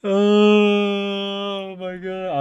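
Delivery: a long, stunned wail of embarrassment; shocked outcry. Real, not acted. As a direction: stretch the oh my God in disbelief. Reacting to his blunder.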